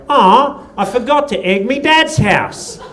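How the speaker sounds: put-on high, slow kid voice; put-on high child's voice; kid on the bus. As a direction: imitate a whining kid on the bus. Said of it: "one of them said to the other kid".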